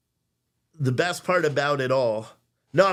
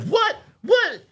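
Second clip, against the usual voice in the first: much higher, stunned double take; shocked disbelief; 5 dB louder than usual. Retold or imitated. Son shocked to hear he's grounded, high and stunned. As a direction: squeak it out in total disbelief.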